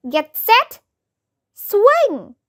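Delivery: mildly shouted countdown, punched words; shouting. A narrator's reading. Child gives the signal to swing, mild shout. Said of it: "I shouted".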